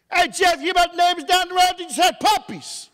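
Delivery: high, lightly voiced neighbor calling out; a hint of a high friendly neighbor; neighbor's voice. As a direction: pitch it up into a chatty neighbor. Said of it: "Our neighbor said to us".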